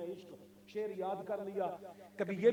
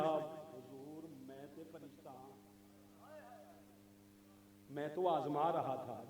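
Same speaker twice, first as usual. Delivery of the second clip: humble confession; 3 semitones lower, 14 dB quieter than usual. Acted. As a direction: confess it in a hushed, lowered voice. Angel humbly confesses to Ibrahim. (धीमी, दबी आवाज़ में विनम्र इक़रार)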